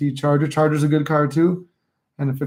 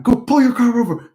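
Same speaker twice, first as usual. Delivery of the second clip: higher, louder retold command to the other driver; angry order; 8 semitones higher, 5 dB louder than usual. To the other driver after the crash, an angry higher louder order. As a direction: bark it at the driver who hit you. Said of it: "I'm like".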